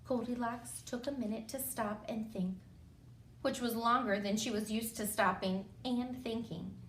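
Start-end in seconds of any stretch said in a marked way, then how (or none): none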